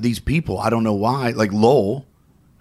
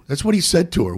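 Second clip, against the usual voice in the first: ordinary talk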